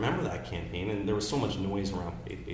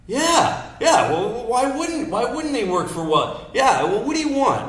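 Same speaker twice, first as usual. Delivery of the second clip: high, loud laughing biker voice; mock biker; 11 dB louder, slower than usual. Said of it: "He goes".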